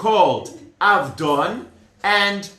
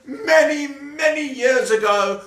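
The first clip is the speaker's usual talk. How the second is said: "many, many" high and stretched out; 8 semitones higher than usual. Real, not acted. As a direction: stretch "many, many" in a raised storytelling swell. Storyteller exaggerates how long ago.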